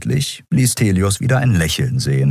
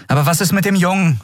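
high-pitched, quick, insistent question; urgent question; 12 semitones higher, faster than usual. Acted. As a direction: press for news of the boy. Urgent question about the boy. (drängend, schnell und hoch)

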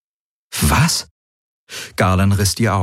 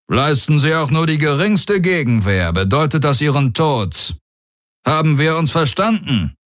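higher, cold warlord warning; threatening warlord; 8 semitones higher than usual. Acted. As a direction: lay down a deadly condition calmly. Daraios warns Galen.

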